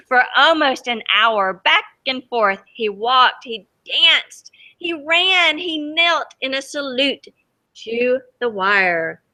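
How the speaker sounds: reading a picture book aloud to the class; lively read-aloud for kids; storybook reading. A narrator's reading. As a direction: tell it gently to children, letting the wonder show. Teacher reads story to children.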